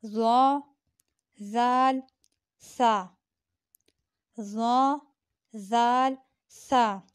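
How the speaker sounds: ज़ा ज़ाल सा each said with the tongue tip between the teeth; a careful soft-letter drill. Shown as a demonstration. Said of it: "ज़बान की नोक से अदा होते हैं".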